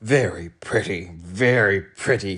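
slow, sarcastic grumble; scowling sarcasm. A narrator's reading. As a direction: scowl over the wreckage. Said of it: "he scowled".

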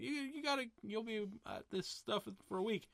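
light, high mock film line; acted cute quote. Host quoting the line at the door, high voice. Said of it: "He's like".

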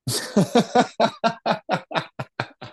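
long high burst of laughter; high-pitched rolling laugh; real laughter. Real, not acted. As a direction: crack up at a punchline. Cracks up at shamans' punchline.

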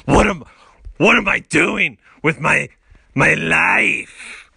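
loud, high anguished outcry; mock despair. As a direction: wail it at the mirror, hammed up. Acted self-loathing speech to the mirror.

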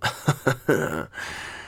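soft, trailing chuckle; quiet, tickled laughter; giggle. Real, not acted. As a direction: giggle at your own mental picture. Giggles at the image.

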